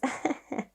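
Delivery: short, soft, real chuckle; amused laugh. Real, not acted. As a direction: let a quiet laugh slip out. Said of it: "I'm just laughing".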